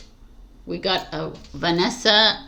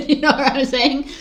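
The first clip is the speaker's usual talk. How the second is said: higher, laughing question; 7 semitones higher, 3 dB louder than usual. Real, not acted. Laughing as she asks the viewers. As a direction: toss it out with a laugh.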